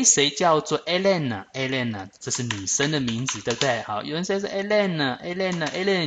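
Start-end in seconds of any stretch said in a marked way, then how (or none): 4.5-6.1 calling out. Acted. Calling out for Hélène.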